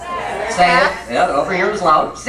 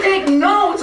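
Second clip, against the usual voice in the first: much higher disbelieving echo; incredulous echo; 13 semitones higher, 4 dB louder than usual. Retold or imitated. Husband echoing his wife's odd request, incredulous. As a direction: repeat it as if it's absurd.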